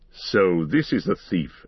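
put-on low, slow old wizard voice; grave wizard, measured and dark; Gandalf. A narrator's reading. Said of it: "said Gandalf".

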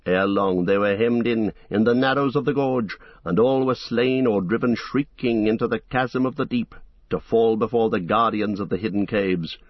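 usual speaking voice

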